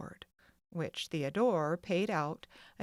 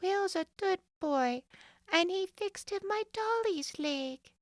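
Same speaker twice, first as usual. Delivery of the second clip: put-on higher small child's voice; little girl; 13 semitones higher than usual. A narrator's reading. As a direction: sound like a tiny girl. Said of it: "said little Gladys".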